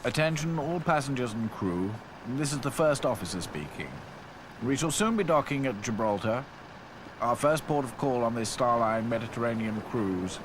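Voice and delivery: formal officer over the ship's speakers; ship's officer announcing over loudspeakers; loudspeaker announcement. A narrator's reading. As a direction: read it like a public address. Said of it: "announcement came over the ship's loudspeakers".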